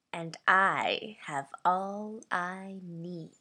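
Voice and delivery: slow, drawn-out menace, mostly low; sinister, menacing. Shown as a demonstration. Voice actor's audition read of offering a gift, sinister and menacing. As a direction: stretch the words out like a veiled threat. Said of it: "This is my sinister, menacing read".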